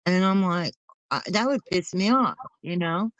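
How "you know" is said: ordinary talk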